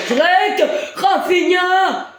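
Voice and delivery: high, slow, drawn-out goal call; excited sports commentary. Acted. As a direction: stretch the scorer's name out.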